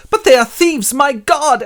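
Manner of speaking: loud, high cry of horror; shocked boy. Acted. Orphan sees his friends stealing, shocked high cry. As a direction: exclaim as a scandalised child. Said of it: "when Oliver sees this, he goes".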